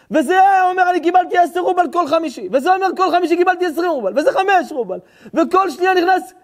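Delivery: high, loud, agitated dramatic telling; impassioned storytelling. Acted. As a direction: cry out the tale at full force. (סיפור נרגש בקול רם וגבוה)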